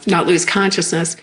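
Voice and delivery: ordinary talk, nothing marked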